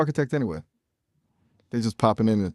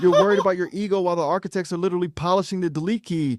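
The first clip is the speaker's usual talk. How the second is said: doomsday rant; 8 semitones higher than usual. Acted. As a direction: bellow the end of the world at him. AI prophet yelling at streamer.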